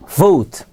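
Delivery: "vote" begun with a buzzing V; "vote" with a voiced v, not b; English V sound. Shown as a demonstration. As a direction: say "vote" with lip on teeth for V. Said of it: "이 V도 우리 발음에는 없죠".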